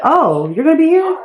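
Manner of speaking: a lightly voiced girl caught off guard; surprised Sim girl.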